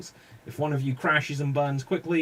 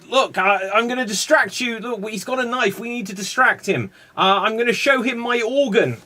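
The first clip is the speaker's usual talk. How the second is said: raised, higher-pitched improv urgency; urgent mock alarm; 7 semitones higher, 4 dB louder than usual. Performer to scene partners about distracting a man with a knife, mock alarm. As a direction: play up a hasty plan to create a distraction.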